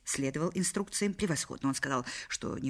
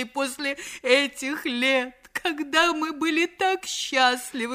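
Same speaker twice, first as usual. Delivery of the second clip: high, slow, imploring voice; pleading wife; 8 semitones higher, 3 dB louder than usual. Acted. Lightly voiced wife begging Reggie to come home, high and slow. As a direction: beg him to come back.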